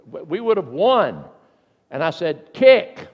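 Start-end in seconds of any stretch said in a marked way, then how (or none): 2.5-3.1 sharp loud shout at the refs; yelled Kick. Retold or imitated. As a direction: bark it out like an angry fan. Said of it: "That's all I said".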